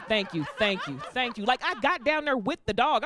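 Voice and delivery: usual speaking voice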